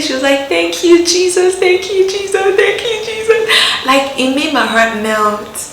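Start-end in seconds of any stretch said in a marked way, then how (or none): 0.5-3.5 higher and higher, joyful and grateful; excited thanks. Retold or imitated. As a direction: praise Jesus in rising delight. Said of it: "she was so excited".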